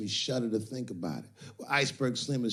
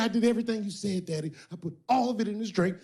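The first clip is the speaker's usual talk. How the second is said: much higher, fast, mock panicked woman; scared woman; 9 semitones higher, faster than usual. Woman explaining to the pimp, higher and hurried. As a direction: rush it out high like a frightened girl. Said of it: "She said".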